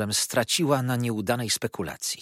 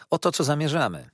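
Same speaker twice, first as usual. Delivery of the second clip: quick, higher-pitched cut-in; interrupting; 7 semitones higher, 4 dB louder, faster than usual. A narrator's reading. Lawyer cutting off the old mother, quick interruption. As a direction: break in briskly, pitch lifted. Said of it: "przerwał Traddles".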